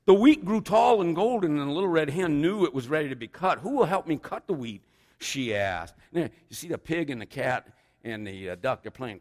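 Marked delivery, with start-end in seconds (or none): 0.1-5.9 higher, louder animated storytelling; big picture-book reading; story narration. A narrator's reading. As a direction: project it to a room of children. Reads a children's story aloud.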